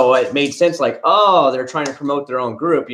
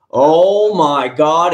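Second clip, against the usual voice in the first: stretched-out, louder oh my God; drawn-out exclamation; 4 semitones higher, 5 dB louder, much slower than usual. Real, not acted. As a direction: drag it out in disbelief. Exclaims about the drama queens.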